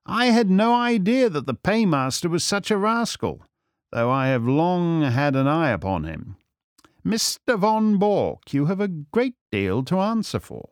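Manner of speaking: lofty storybook sleuth; composed, reproving Holmes. Acted. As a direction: take the rascal to task in a high, measured voice.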